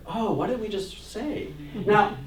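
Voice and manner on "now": usual speaking voice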